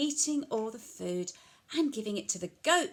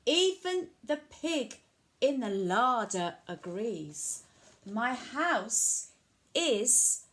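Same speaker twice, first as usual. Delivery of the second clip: bouncy rhyming storytelling, stretched refrain; reading to children; 4 semitones higher, 5 dB louder, much slower than usual. A narrator's reading. Old lady begs wise man for help, animated storytelling. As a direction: punch out the rhyme with pauses between phrases.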